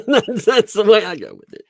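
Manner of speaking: laughing through the words. Real, not acted. Laughs at his own mispronunciation.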